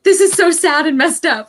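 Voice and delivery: hammed-up despair at the book's ending; dismayed outburst. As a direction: replay your gut reaction, overdone. Retold reaction after finishing book.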